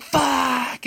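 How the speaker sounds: high mock scream, not full volume; drawn-out high yelp of a curse, held back in volume; retold scream. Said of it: "I just start screaming".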